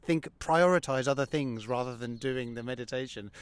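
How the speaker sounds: ordinary talk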